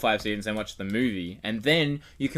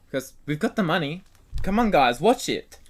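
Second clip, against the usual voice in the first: high, loud mock sales pitch; hammed-up coaxing, pitched high; mock pleading pitch; 7 semitones higher, 3 dB louder than usual. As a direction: imitate a studio begging for viewers. Mock quote voicing Marvel's makers.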